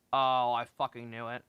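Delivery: usual speaking voice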